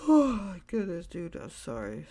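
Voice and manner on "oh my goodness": yawning. Real, not acted. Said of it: "Sorry, I'm just yawning".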